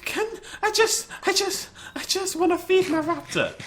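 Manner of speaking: very high, squeaky plea; exasperated pleading. Real, not acted. Pleading while under game attack.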